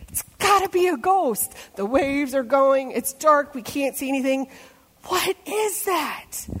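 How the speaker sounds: high, scared voices of disciples; frightened disciples. Retold or imitated. Disciples in the boat, terrified of the figure on the water. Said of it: "they're like".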